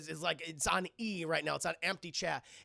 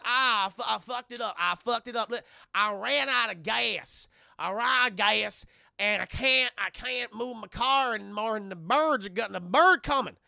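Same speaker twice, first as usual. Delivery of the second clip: hammed-up Southern twang, words bursting out loud; cowboy stranger; 3 semitones higher, 7 dB louder than usual. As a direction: beg like a desperate backwoods cowboy. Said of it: "this guy in a cowboy hat".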